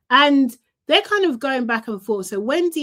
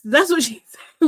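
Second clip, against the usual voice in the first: laughing; 5 semitones higher, 5 dB louder than usual. Real, not acted. Laughs mid-line.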